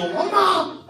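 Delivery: very high, comic cry; hammed-up high-pitched call; calling for mom. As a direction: mock-desperate shriek for mother. Acted call to mother.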